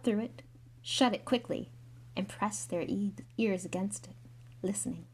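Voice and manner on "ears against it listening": ordinary delivery, nothing marked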